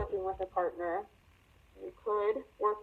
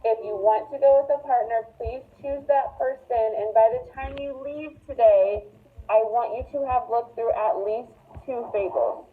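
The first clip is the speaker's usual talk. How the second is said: loud, projected classroom instructions; louder, emphatic directions to students; raised teacher voice; 9 dB louder than usual. Real, not acted. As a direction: project firmly to the whole class.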